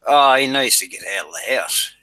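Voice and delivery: normal talking voice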